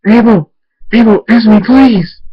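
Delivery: loud, high pleading shout; desperate yell. A narrator's reading. Father searching his room for Abel, desperate yell. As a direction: yell for a missing loved one. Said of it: "and I yell".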